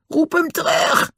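very high, strained cry; panicked yell. A narrator's reading. Den screams for Dick to call Pongo off, panicked. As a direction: yell it high without much volume. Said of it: "schreeuwde hij".

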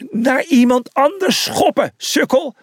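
high, exasperated yell; high-pitched mid-game telling-off; scolding shout. Acted. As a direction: yell at a bungling teammate. Teammate yells during the game.

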